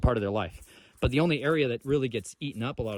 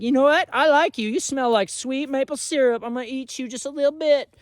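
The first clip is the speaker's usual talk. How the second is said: high, sweet talking deer; a small deer flirting with a maple sapling; high cutesy deer voice; little deer voice; 6 dB louder than usual. Retold or imitated. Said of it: "A little deer came through this forest and was like".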